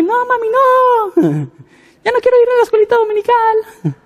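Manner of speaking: put-on very high, whiny child voice; a frightened little kid begging mom; scared child. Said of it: "Es como que". (niño asustado, voz muy aguda y quejumbrosa)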